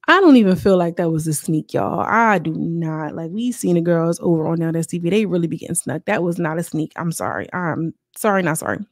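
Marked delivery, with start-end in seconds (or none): none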